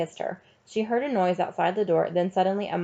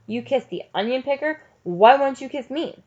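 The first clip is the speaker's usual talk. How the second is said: raised, higher-pitched mocking questions; taunting challenge; 6 semitones higher, 4 dB louder than usual. A narrator's reading. Sheriff to the teacher who slapped him, taunting. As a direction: goad her, getting louder on the second question.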